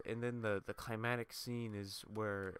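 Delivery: usual speaking voice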